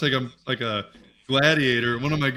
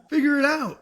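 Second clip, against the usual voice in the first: mock old-school advice; 11 semitones higher than usual.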